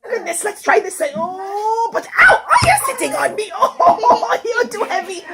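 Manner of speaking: high strained yelps broken by laughs; straining and laughing. Acted. Rich man struggles with his heavy cow, high strained yelps and laughter. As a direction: heave at a sitting cow, laughing through the ows.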